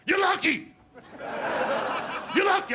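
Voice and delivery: high, loud outburst; indignant shout. Acted. Comic yelling back at the people on TV, indignant shout. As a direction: yell it back in mock outrage.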